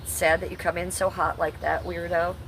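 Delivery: ordinary delivery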